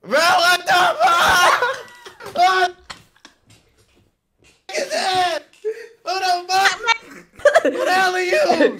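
high, loud, laughing disbelief; shocked outburst. Real, not acted. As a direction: yell in horrified, cracking-up shock. Shock at blindfold reveal.

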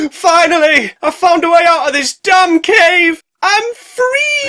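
high, loud joyful yell; triumphant shout. Acted. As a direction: cheer like a freed prisoner. Snake voiced escaping cave.

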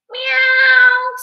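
very high, loud yowl; long caterwauling meow; singing cats. A narrator's reading. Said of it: "sang the cats on the wall".

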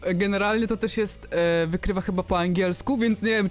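ordinary talk, nothing marked

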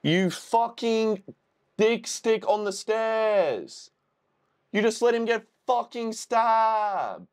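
high, outraged ranting; furious disbelief at the screen; angry outburst. Real, not acted. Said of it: "I'm so fucking mad at him".

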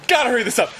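comic rising panic while hurrying; mock panic. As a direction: hurry yourself along, pitched high. Growing panic on the john.

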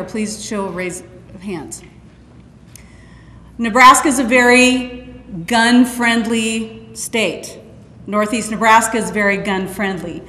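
usual speaking voice

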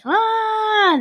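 long, high mock scream; drawn-out high "ah" of someone falling; falling yell. A narrator's reading. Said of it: "yelled as he fell".

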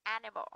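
"animal" stressed on AN; first-syllable stress. Shown as a demonstration. Teacher confirms the rule with example, first-syllable stress. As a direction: say "animal" with weight on the first syllable, falling after. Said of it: "Các bạn sẽ đánh vào âm đầu tiên".

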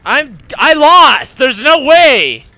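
loud frustrated yell; yelling I lost; real shout. Real, not acted. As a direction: shout out in disbelief at losing. Losing the match, yelling.

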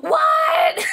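very high, loud, incredulous cry; shocked shriek. Real, not acted. Vlogger stunned by the first thing in the box, shocked shriek. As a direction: shriek it out in total disbelief.

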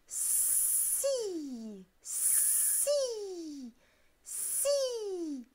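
hissed like a snake, rising high. Acted. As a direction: stretch each si into a snaky hiss. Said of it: "il siffle en imitant le serpent".

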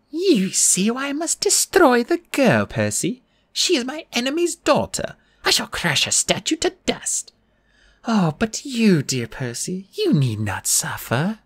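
high, slow, coaxing purr; comforting purr. A narrator's reading. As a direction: purr sweetly while meaning harm. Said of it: "back to a comforting purr".

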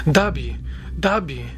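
calling out, shouting. A narrator's reading. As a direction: call for the child in alarm. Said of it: "krzycząc". (wołanie podniesionym głosem)

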